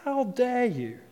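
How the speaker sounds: same pretend offence, pulled back in volume; mock outrage. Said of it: "You're like".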